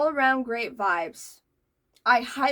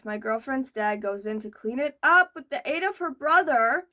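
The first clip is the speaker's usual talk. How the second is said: normal talking voice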